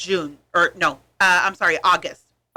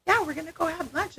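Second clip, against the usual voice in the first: lightly acted casual mom, higher pitch; everyday mom talk; 8 semitones higher, 4 dB quieter than usual. Mom telling her kids the plan, casual everyday voice. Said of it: "you could be talking to the kids".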